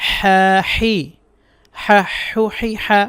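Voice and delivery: slow, clear হা হু হি হা with a breathy throat h; repeat-after-me drill. Shown as a demonstration. Teacher leading students in letter practice, slow recitation. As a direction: stretch each হা so students can copy. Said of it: "আমার সাথে সাথে বলেন".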